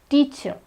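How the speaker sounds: teacher ending on an open vowel; teacher with no final R; silent R. Shown as a demonstration. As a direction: say teacher as TEE-chuh. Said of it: "the R is silent".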